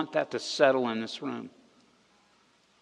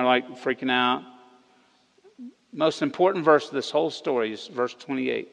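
normal talking voice

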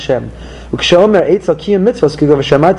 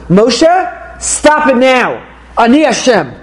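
higher, slow and firm: God cutting Moshe off; stern God; 7 semitones higher, much slower than usual. Acted. God to Moshe, ending his complaint: stern, slow command. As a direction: put a stop to it like a commanding God.

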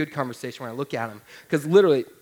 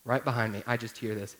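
normal talking voice

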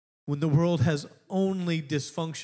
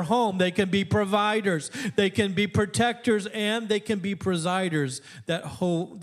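preaching at full force; 4 semitones higher than usual. Real, not acted. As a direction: build it up like a preacher hitting the peak.

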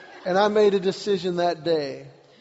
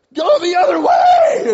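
high, drawn-out full-force holler; urgent shout; 13 semitones higher, 15 dB louder, slower than usual. Retold or imitated. Husband directing wife away from ship, urgent high shout. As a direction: bellow it across the water, stretched out.

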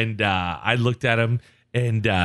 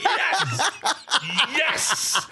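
very high, laughing squeal of triumph; elated retelling; 12 semitones higher than usual. Retold or imitated. Team man hearing his sponsor prayed for, high gleeful squeal. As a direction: re-enact delight with a high, laughing yelp. Re-enacting delight at sponsor mention.

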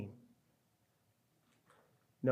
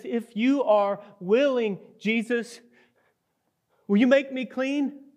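high, louder desperate begging; pleading leper; 6 semitones higher, 5 dB louder than usual. Acted. Leper kneels before Jesus, pleading high and loud. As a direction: beg Jesus like a man with nothing left.

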